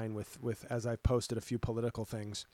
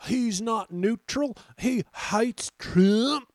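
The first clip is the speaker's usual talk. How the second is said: high, slow whiny put-on voice; hammed-up shocked Trump fan; mocking whiny critic; 8 semitones higher, 5 dB louder, slower than usual. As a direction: mimic an outraged fan, higher and louder. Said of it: "I'm like".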